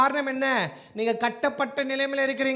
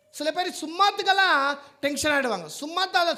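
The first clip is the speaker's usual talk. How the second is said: ordinary delivery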